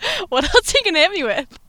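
high, loud and laughing. Real, not acted. Patient asking doc what's next, laughing. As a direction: laugh through the question. Laughs about endless diagnoses.

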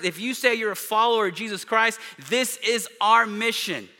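fervent pulpit proclamation; full-force preaching. Acted. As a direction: press the congregation hard. Preaching climax.

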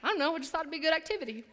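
put-on fast, offhand kid excuse; fast offhand kid shrug; casual excuse. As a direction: shrug it off quickly.

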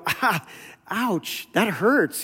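mock wince. Congregation stung by Bonhoeffer's sermons, mock wince. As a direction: wince as if stung, half in jest. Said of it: "people would go".

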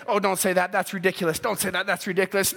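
low, dismissive inner voice; devil's discouraging voice. Acted. Devil putting doubt in Joshua's mind, low and dismissive. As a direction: drop low, a doubting voice in the head. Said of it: "what the devil be to put in his mind, saying".

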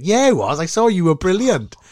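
high, eager gushing; gushing fan. Retold or imitated. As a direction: overdo the excited fan, half-mock. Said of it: "I was like".

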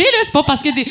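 normal talking voice

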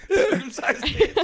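high, slow, laughing through it; cracking up mid-word. Real, not acted. Laughing at the joke.

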